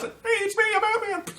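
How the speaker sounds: very high put-on little voice; Ant-Man. Hero answering from inside armor, high mock voice. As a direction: pipe up in a tiny, high voice.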